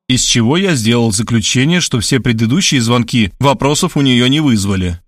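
ordinary delivery, nothing marked

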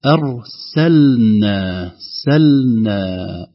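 very slow "أرسلنا", the l held before the n; clear lam. Shown as a demonstration. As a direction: draw out "سلنا" with a distinct l. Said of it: "اللام الساكنة إذا جاء بعدها نون". (نطق "أرسلنا" ببطء مع إظهار اللام)

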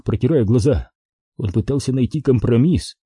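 usual speaking voice

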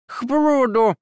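garbled high squeak through pie; mumbled words with a full mouth. A narrator's reading. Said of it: "с набитым ртом".

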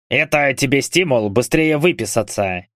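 ordinary delivery

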